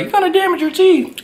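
squeaky high mocking voice; mocking viewers. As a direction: mimic nagging viewers, way up high. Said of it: "you're like".

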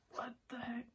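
soft, breath-only disbelief; stunned whisper. Real, not acted. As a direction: whisper it in astonishment. Astonished at a photo.

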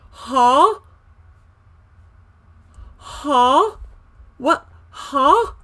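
high, loud, rising "huh"; shocked disbelief. Real, not acted. Shocked at the spider rule.

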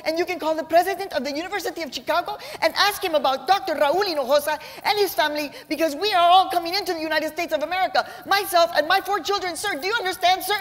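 a small woman drawing herself up, pleading hard; mom's panicked high voice. Retold or imitated. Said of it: "her voice and her kitten heels on her tiptoes saying".

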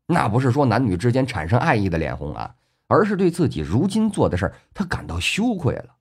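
ordinary delivery

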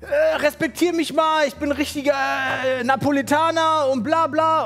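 high-pitched whiny imitation of the man; mocking impression of a self-important Neapolitan; mocked stranger. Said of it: "Und dann sagt er so zu mir".

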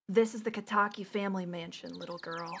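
low, soft character-lady voice; low lady voice attempt. Lady to little girl at family mansion, low soft character voice. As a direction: drop low and quiet for the lady. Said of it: "I have to give her a voice".

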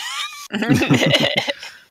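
very high, shouted burst of laughter; shrieking laugh. Real, not acted. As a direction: let a real laugh explode up high. Laughs after shouting about MS Paint.

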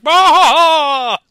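booming, hammed-up villain bellow; mock-evil roar, pushed up high and very loud; Vatu. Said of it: "the deep voice like".

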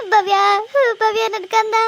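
put-on loud grieving cry of her child's name; wailing call. Mother calling after her daughter Bhavya, loud grieving wail.

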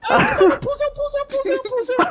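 panicked pleading. Real, not acted. Begging friend to pause.

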